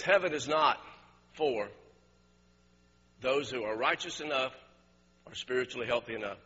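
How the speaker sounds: low, measured, quiet preaching; altar appeal. Real, not acted. Preacher addressing bowed heads about needing a doctor, quiet appeal. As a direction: appeal gently, leaving long pauses.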